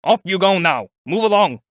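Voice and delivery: loud policeman's bark; shouting. A narrator's reading. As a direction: order the crowd away loudly. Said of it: "he shouted".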